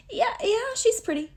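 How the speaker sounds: very high-pitched imitated reply; squeaky-high mock agreement; amused humoring reply. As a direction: put on a high voice, as if humoring someone. Voices his amused, humoring reply.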